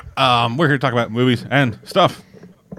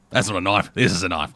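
put-on Australian accent; 5 semitones lower than usual. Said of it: "We are terrible at doing accents".

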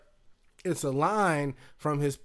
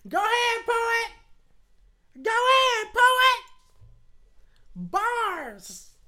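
high-pitched mom voice; much slower than usual. Retold or imitated. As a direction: yell it like an excited mom at a show. Mom shouting at poetry show.

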